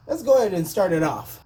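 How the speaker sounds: normal talking voice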